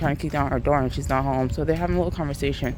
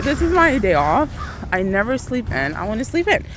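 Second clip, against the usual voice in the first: exasperated; 6 semitones higher than usual. Retold or imitated. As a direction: complain like you're losing sleep. Kept awake, annoyed at 3am.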